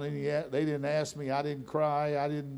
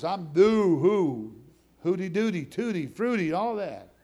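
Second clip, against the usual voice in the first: goofy sing-song; 4 semitones higher, 5 dB louder than usual. Retold or imitated. Mocking his old showy self.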